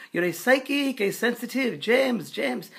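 put-on high, loud French-accented proclamation; imitating an excitable French spirit, pitched high; screaming Frenchman. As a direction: call out fervently in a French accent. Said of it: "and screaming".

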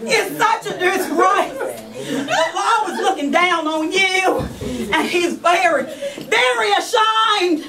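high, drawn-out sermon scolding; scolding preacher. Acted. Preacher shaming the woman before the congregation, mock sermon. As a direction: preach shame at her, hammed up.

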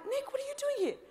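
high, startled "what are you doing here"; a surprised squeak to Nick. Retold or imitated. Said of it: "I'm like".

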